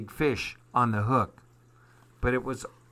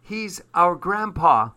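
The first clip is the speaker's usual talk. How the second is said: sobbing; 6 semitones higher, 5 dB louder than usual. Acted. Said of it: "sobbed Evelyn".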